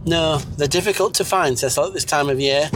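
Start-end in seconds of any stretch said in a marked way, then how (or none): none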